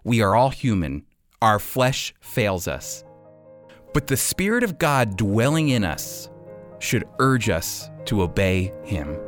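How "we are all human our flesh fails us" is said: ordinary delivery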